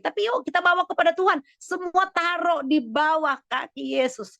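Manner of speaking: high, impassioned preacher's exhortation; fervent preaching. Real, not acted. Pastor urging congregation to bring all to Jesus, fervent preaching. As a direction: lift the voice and urge them to surrender it.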